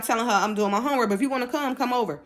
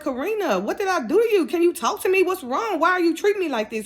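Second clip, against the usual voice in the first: thrown off; 7 semitones higher than usual. Retold or imitated. To friend who is ignoring her, higher bewildered pleading. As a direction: beg her to explain, bewildered. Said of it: "So I'm thrown off".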